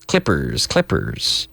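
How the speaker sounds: "clippers" said slowly and low, twice; model pronunciation. Shown as a demonstration. Teacher modelling "clippers" for Spanish learners. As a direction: say "clippers" clearly, dropping low. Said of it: "We call those clippers".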